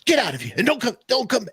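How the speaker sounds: angry soup Nazi yell, high and loud; retold high-pitched tirade; intense yelling. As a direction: yell like a restaurant owner throwing customers out. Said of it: "he's yelling at them, like, intense".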